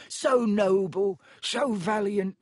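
high, drawn-out elf moan; adoring, anguished high-pitched elf; miserable ecstasy. A narrator's reading. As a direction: gush mournfully in a squeaky elf voice. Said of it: "moaned Dobby in a kind of miserable ecstasy".

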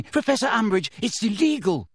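put-on high, urgent girl's protest; shrieked protest. A narrator's reading. Girl warns teacher off a curse, shrieking mildly. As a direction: protest in alarm, pitched well up. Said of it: "shrieked Hermione".

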